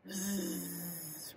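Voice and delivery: soft breathy snore, zzz; snoring sound. Shown as a demonstration. Teacher showing kids a snore. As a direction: make a sleepy snoring buzz. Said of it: "how could you make a snoring sound".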